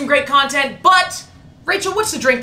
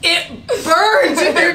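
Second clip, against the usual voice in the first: laughing; 7 semitones higher, slower than usual. Real, not acted. Burning drink after spit take.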